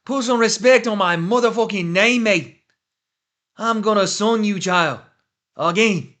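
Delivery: loud, high mock Irish accent shouting the tweet. For chat, loud mock Conor Irish accent. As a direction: belt it out like Conor in a thick Irish brogue. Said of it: "you want it in the accent?".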